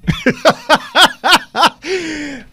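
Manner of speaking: high, ringing burst of laughter; real laughter. Real, not acted. Host laughing at their own gospel parody, real laughter. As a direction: crack up at your own joke.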